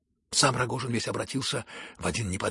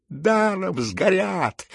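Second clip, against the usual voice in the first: high, loud, drawn-out howl; long anguished shout; roaring; 9 semitones higher, 7 dB louder, much slower than usual. A narrator's reading. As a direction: bellow it like an outraged crowd. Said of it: "ревели со всех сторон".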